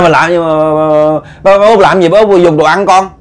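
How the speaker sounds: high, loud, stammering outburst; upset boy's protest. Acted. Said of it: "ổng tức".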